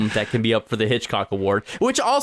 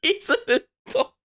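high squeaky laughing protest; laughing squeak. Real, not acted. Laughing disbelief.

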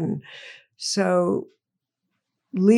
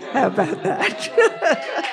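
laughing; 7 semitones higher, slower than usual. Real, not acted. Wry joke, laughing.

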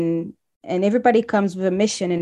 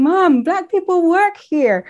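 higher, slower, amazed exclamation; 10 semitones higher, 4 dB louder, slower than usual. Retold or imitated. Newcomer phoning mom from the bank, higher amazed exclamation. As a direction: marvel at it like a discovery. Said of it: "picking my phone and saying".

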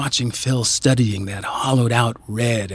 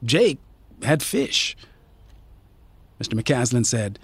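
ordinary delivery, nothing marked

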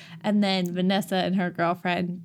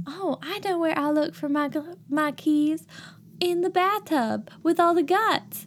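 high, hammed-up Southern belle; 8 semitones higher than usual. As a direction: overact a ditzy Southern belle. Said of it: "sounds like a Southern belle".

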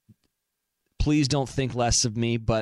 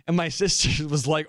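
usual speaking voice

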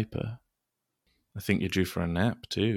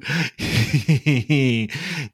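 playful laugh; 5 semitones higher, 5 dB louder than usual. Acted. Laugh during tickle.

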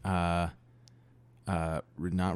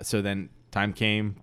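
ordinary talk

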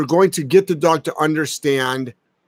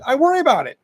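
insistent retort; 10 semitones higher, faster than usual. Retold or imitated. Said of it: "And I'm like".

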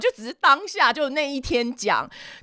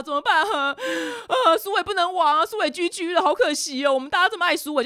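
higher, whiny hammed-up wailing; mock lamenting; 8 semitones higher than usual. Friends bemoan losing Suwei downstairs, mock lamenting. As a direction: moan in exaggerated fake grief. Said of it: "大家就在呜呼哀哉说".

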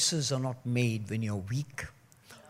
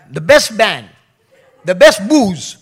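mock party hype; 6 semitones higher, 16 dB louder than usual. Mocking the self-pity party.

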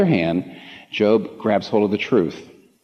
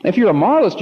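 emphatic preaching; 10 semitones higher, 4 dB louder, faster than usual. Real, not acted.

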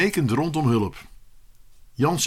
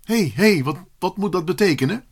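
higher, stumbling indignant question; alarmed protest; 6 semitones higher than usual. Acted. Pupil challenging teacher taking back the paper, alarmed. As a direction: break in, startled, with repeated words.

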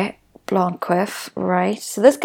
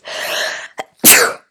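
sneezing. Real, not acted. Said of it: "Sorry, I'm gonna sneeze".

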